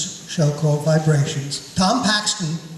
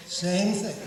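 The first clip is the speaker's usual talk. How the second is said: ordinary talk, nothing marked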